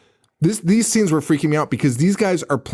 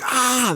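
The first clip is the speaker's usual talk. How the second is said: mock scream; 10 semitones higher than usual. Retelling people fleeing a ray gun, mock scream. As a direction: imitate a panicked scream without full volume. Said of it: "They just start screaming".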